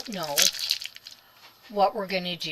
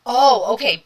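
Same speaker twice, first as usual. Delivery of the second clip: loud; 4 semitones higher, 13 dB louder than usual. Real, not acted. Reacting to someone.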